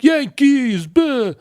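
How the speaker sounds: very high, loud mock fan cry; hammed-up New York sports fan jeer; loud, high mock Yankees fan yell; exaggerated New York fan. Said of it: "they exaggerate that".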